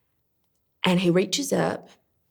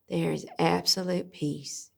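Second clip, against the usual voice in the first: normal talking voice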